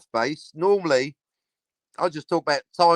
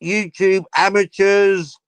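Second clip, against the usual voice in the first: higher, slow, drawn-out jeer; mock catchphrase yell; 6 semitones higher, 4 dB louder, slower than usual. Jeering at YouTube amateurs, slow and mocking.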